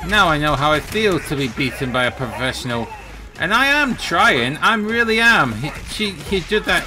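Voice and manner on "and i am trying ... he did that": exasperated. Real, not acted. Struggling against a pro player.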